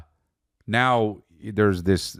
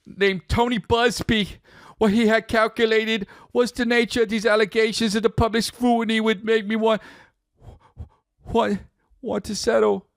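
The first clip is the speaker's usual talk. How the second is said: much higher mock reading voice; 13 semitones higher than usual. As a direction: read it puffed up and grand. Reading [name] statement aloud.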